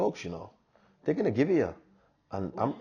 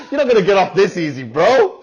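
laughing teasing; 11 semitones higher, 11 dB louder than usual. Real, not acted. Laughing, teasing his co-host.

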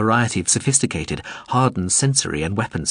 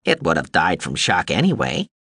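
drone voice; 3 semitones lower than usual. A narrator's reading. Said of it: "Morin Skell said".

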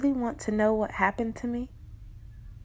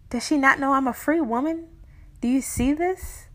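ordinary delivery

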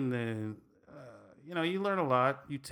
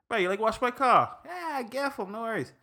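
Hawaiian Pidgin; 7 semitones higher, 3 dB louder than usual. Retold or imitated. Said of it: "pretty much means I take care for you".

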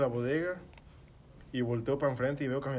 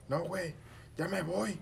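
grito imitado; 8 semitones higher, slower than usual. Retold or imitated. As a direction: act out a worked-up yell. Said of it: "Le empiezo a gritar al". (grito imitado, agudo y alterado)

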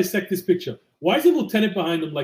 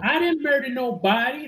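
higher, put-on voice of a young woman; mock perp voice; 7 semitones higher than usual. Co-host imitating the small arrested perp, mock. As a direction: play the innocent-sounding suspect.

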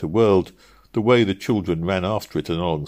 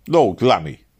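louder, protesting cabman; retorting; 3 semitones higher, 5 dB louder than usual. Acted. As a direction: throw it back, indignant about the fare. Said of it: "he retorted".

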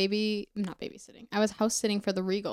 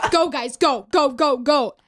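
raised, louder voice rallying the crew; imitated urgent command; 4 semitones higher, 6 dB louder, slower than usual. Imitating big guy in robbery dream, urgent shout. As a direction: play the kidnapper hurrying everyone out. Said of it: "And he goes".

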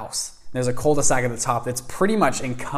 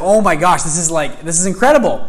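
higher and louder, thrilled exclamation; mind blown; 5 semitones higher, 8 dB louder than usual. Retold or imitated. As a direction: burst out with amazement. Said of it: "my mind was just blowing".

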